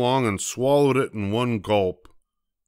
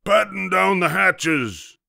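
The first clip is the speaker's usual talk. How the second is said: high, slow order; drawn-out storm command; captain's shout; 9 semitones higher, 3 dB louder, slower than usual. A narrator's reading. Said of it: "Braidbeard shouted".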